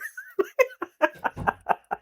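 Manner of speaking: airy laugh way up high; high breathy laugh. Real, not acted. Recapping the episode, cracking up at Adam's line. Laughs after Adam quote.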